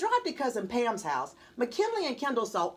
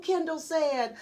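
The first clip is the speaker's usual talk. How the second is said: ordinary delivery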